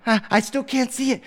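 put-on high little-child complaint; small child starting to fret; whiny toddler. Three-year-old cannot find rainbow.

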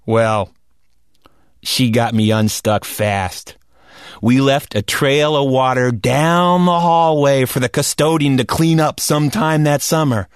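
ordinary delivery, nothing marked

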